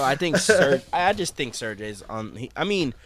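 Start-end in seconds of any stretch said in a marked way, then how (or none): none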